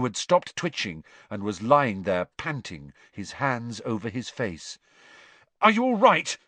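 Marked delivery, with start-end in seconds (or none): 5.6-6.4 loud, high urgent question; rough. A narrator's reading. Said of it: "Harry said roughly".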